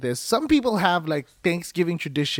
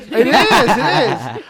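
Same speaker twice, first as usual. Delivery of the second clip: laughing; 5 semitones higher, 8 dB louder, much slower than usual. Real, not acted. Laughing defence of lotion joke.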